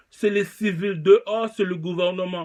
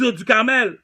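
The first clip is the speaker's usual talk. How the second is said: normal talking voice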